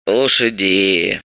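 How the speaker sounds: low, slow, weary croak; resigned old crone; sighing old woman. A narrator's reading. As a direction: sigh it out slowly and low. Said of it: "вздохнула та".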